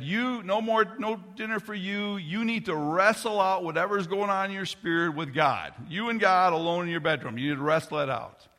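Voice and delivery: put-on a father sending his child to their room, firm and loud; stern father scolding. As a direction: lay down the law to your kid.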